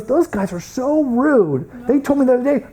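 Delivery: high-pitched, offended complaint; mock indignation. Director complaining at a staff meeting, mock indignation.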